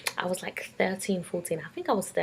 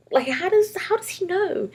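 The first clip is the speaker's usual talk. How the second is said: higher, louder shocked disbelief; 13 semitones higher, 6 dB louder than usual. Retold or imitated. Girl shocked brother knows her crush, shocked. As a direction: gasp it out in shock.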